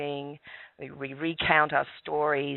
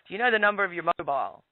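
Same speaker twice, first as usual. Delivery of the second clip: quicker retake of the officer's question with "mobile"; officer's question, corrected wording; 3 semitones higher, much faster than usual. Retold or imitated. Retelling the officer's question, fast and lightly voiced. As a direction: redo the cop's line fast with "mobile". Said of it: "Actually, they call it mobile phone".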